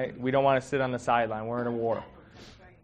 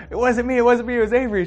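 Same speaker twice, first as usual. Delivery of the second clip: put-on high, loud little-kid protest; child denying; 11 semitones higher, 8 dB louder, faster than usual. Kid pleading it wasn't her, high and loud. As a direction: squeal the denial like a small child.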